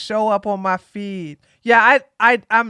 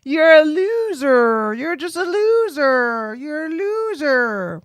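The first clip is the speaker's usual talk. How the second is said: sing-song mockery; 4 semitones higher, slower than usual. Real, not acted. Mocking sing-song taunt.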